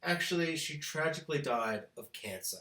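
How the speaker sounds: hushed mock tragedy; mock solemn writers. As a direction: put on a fake sombre tone. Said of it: "then be like".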